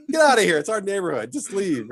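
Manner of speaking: shooing yell. Retold or imitated. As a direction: chase him out of the neighborhood. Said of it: "I was like".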